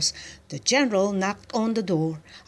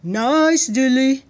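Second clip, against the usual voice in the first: slow, loud, higher-pitched coaxing; coaxing call; 5 semitones higher, 7 dB louder, slower than usual. A narrator's reading. General luring the duck, coaxing. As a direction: draw it out to lure an animal. He called.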